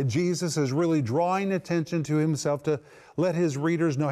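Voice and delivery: usual speaking voice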